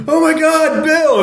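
high panicked scream; mock scream. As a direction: scream his name in mock terror. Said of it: "we're screaming like".

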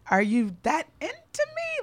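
incredulous question. Retold or imitated. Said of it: "made me feel like".

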